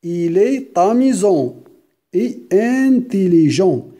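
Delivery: "Il est amusant" said slowly with a t linking "est" to "amusant"; slow, sound the t between "est" and "amusant"; liaison in il est. Shown as a demonstration. Teacher drilling the liaison slowly, "est" tied to "amusant" by a t. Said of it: "regarde la liaison".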